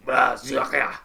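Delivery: loud warrior exclamation; loud, forceful foreign-tongue outburst; towering warrior. Acted. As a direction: bark it down at a smaller man. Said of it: "looks down at him and goes".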